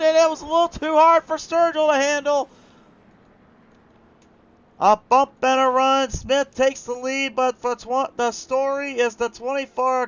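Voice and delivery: loud, high, drawn-out commentator's cry; excited race play-by-play. Real, not acted. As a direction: belt out the big moment on the track. Live race call of a crash.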